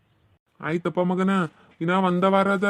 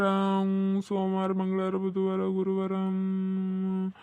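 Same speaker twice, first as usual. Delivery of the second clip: counting under breath; 6 dB quieter, slower than usual. Acted. Counting days aloud, thinking.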